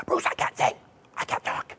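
hoarse whisper, voice fully gone; voice lost. Retold or imitated. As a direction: rasp it out with no voice at all. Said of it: "still couldn't sing".

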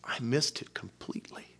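soft, repentant whisper to God; hushed prayer of repentance. Acted. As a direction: confess it under your breath. Praying in confession to God.

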